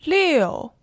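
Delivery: Liu falling sharply from high; fourth tone. Shown as a demonstration. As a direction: snap Liu straight down. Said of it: "Here are the four tones".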